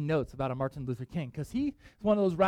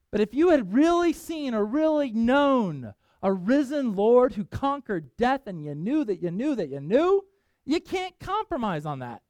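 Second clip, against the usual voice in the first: preaching at full force; 8 semitones higher, 6 dB louder, slower than usual. Real, not acted. Preacher building to climax.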